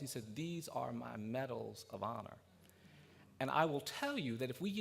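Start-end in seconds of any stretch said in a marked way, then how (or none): 0.4-2.4 slow, soft, weighty declaration; imitated elder's hushed, measured pronouncement; solemn dignity. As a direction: lower the voice and give each word room. Old man's proud climax, retold.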